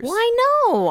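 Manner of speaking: high, loud, eager burst; excited. Real, not acted. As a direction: jump in, thrilled. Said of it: "And then you got all excited".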